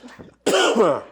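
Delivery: loud sharp cough; coughing fit. Real, not acted. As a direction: hack out a cough. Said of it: "water went down the wrong pipe".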